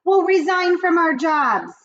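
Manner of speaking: high loud eager witches; witch chorus. Acted. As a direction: agree like a cackling coven.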